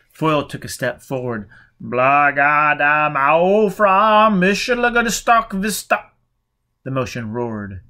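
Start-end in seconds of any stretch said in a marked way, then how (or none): 1.8-6.1 slow, loud, higher, warped speech; distorted roar. A narrator's reading. Foyle's voice reaches the burning man as a loud warped roar. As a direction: smear the words like a roaring distortion.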